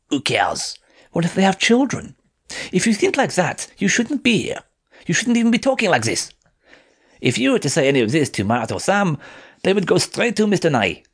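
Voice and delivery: a hint of a cold French girl, irritated; Colette's voice, sharp. A narrator's reading. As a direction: warn him off, pitched well up. Colette lectures Yasin sharply.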